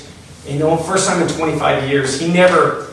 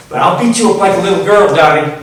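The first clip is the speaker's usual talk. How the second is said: put-on louder and higher drunken threat; threatening taunt; 5 semitones higher, 6 dB louder than usual. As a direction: jeer it at him, spoiling for a fight. Drunk friend's threatening voicemail.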